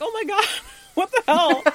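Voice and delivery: high, loud exclamation breaking into laughter; mock shocked outcry. [name] reacts to the thrown towel, high mock-shocked outcry. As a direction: exclaim in laughing, exaggerated disbelief.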